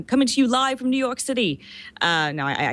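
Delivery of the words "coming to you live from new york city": attempted radio DJ accent. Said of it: "I can't do the accent".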